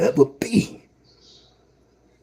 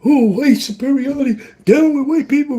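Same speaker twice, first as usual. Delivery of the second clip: mocking quote; 5 dB louder than usual. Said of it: "a lot of you be like".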